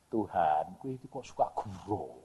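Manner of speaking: low, very soft aside to God; hushed mock reproach; quiet complaint. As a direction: question God under your voice.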